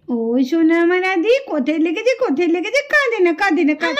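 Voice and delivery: put-on calm the crying boy gently; soothing a child. Aunt soothing a hurt child. (আদর করে শান্ত করা)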